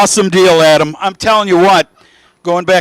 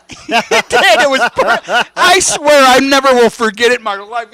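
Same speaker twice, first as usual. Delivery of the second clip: higher, laughing through the words; real laughing; 8 semitones higher than usual. Real, not acted. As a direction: crack up while telling the story. Laughing at own funny anecdote.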